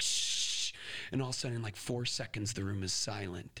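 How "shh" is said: whispered shh shh shh; shushing. Retold or imitated. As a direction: hush the kids behind. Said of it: "They're like".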